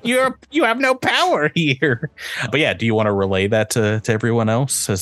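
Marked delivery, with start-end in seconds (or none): 0.0-2.1 high teasing rebuke; mock scolding. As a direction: scold them like a naughty pet. GM scolds player trying to eat lock.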